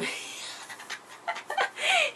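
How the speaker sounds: high breathy laugh; helpless high-pitched laughter; cracking up. Real, not acted. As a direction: burst out laughing mid-sentence. Cracks up mid-sentence.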